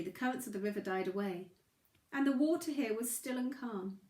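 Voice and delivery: ordinary delivery, nothing marked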